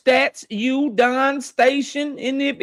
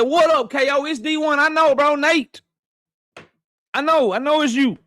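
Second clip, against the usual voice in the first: friend's quote, voiced higher; voicing a friend; 5 semitones higher than usual. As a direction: lightly imitate the friend, higher pitch. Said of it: "He said".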